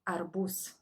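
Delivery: ordinary talk, nothing marked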